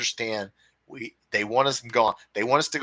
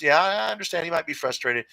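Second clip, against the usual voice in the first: ordinary delivery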